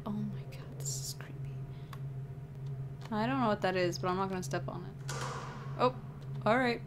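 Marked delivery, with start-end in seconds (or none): 0.1-1.5 hushed, low whisper; creeped-out whisper. Real, not acted. Player finds the dark path creepy, uneasy whisper. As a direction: whisper it uneasily under your breath.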